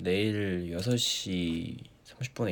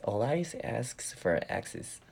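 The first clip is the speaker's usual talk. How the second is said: slow, careful tongue twister; slow drill; 2 semitones higher, 4 dB quieter, much slower than usual. Shown as a demonstration. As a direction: space out every word deliberately. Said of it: "발음 연습하거든요".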